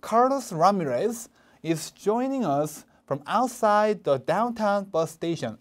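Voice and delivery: "outside" hit hard, "downtown bus station" word by word; slow, punch "outside", then each word crisp; stress on outside. Shown as a demonstration. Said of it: "Outside 세게 읽어주시고".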